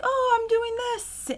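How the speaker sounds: high, sing-song imitation of herself; mock cheery self. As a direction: chirp it like a carefree announcement. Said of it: "I'll be like".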